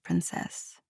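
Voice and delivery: breathy whispered princess, voice dropping away; soft whisper. Acted. Prince murmuring a pet name to her, whispered.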